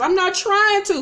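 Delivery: high-pitched imitated tearful protest; mock crying. Wife protesting to husband through tears, mock crying. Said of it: "Toya steady crying and shit times".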